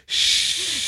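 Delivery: long imitated "shh"; shushing. Mimicking the co-host's shushing, long hissed hush. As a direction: draw out a hush. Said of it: "have a quiet podcast".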